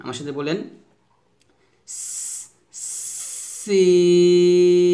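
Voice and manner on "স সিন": thin hiss into long-drawn সিন; thin normal S. Shown as a demonstration. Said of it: "নরমালভাবে উচ্চারণ করতে হবে".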